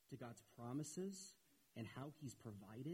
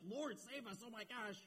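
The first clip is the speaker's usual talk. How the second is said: panicked plea; 9 semitones higher than usual. Retold or imitated. Preacher voicing the frightened disciples waking Jesus, mock panic. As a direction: cry out like men sinking in a storm.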